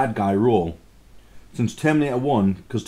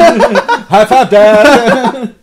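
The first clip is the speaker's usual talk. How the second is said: high, loud, laughing cheer; loud laughing shout; 11 semitones higher, 13 dB louder, much slower than usual. Acted. Acting out a son scene.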